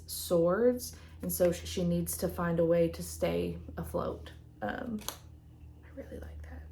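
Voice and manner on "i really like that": soft breathy whisper to herself; whispered aside. Real, not acted. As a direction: murmur it as a private aside. Aside while showing tarot cards.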